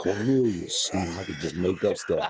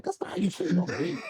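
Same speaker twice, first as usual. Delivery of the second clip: mother scolding in a much higher, distinct voice; be his upset mom correcting him; strict mother; 11 semitones higher than usual. Retold or imitated. Mother correcting young [name]'s spelling, high scolding imitation. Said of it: "And she was like".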